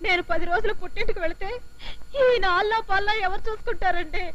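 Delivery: slow, high weepy wail of a wife; sobbing over leaving for ten days; crying wife. Acted. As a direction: wail it out slowly in a falsetto. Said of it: "మరైతే ఎందుకు ఏడుస్తున్నావు?".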